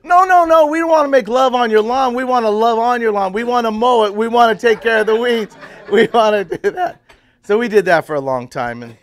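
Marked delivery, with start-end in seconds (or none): 0.0-7.0 loud, high, laughing all through; laughing explanation. Retold or imitated. Explaining to the neighbour he meant mowing, laughing loudly. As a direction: laugh while you set the mix-up straight.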